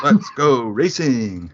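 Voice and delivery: slow, loud, high rallying cry; Jost Capito catchphrase. Retold or imitated. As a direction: belt it out like a team boss. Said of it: "I think he's the guy in Drive to Survive".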